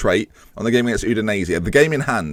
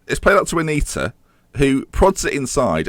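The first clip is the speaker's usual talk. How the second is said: usual speaking voice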